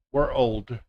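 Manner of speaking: put-on "we're old" split into two words. Shown as a demonstration. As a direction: break it into "we're" then "old". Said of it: "like you're saying two words".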